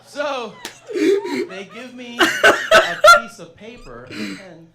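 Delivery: slow, high, laughing through words; can barely get it out for laughing. Real, not acted. Laughing while telling vomiting.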